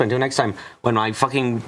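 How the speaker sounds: ordinary talk, nothing marked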